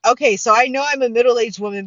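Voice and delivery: normal talking voice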